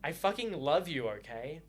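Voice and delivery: ordinary talk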